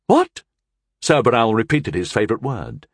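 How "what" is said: high, loud startled yelp; surprised. A narrator's reading. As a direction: blurt it in shock. Ganulin startled outburst.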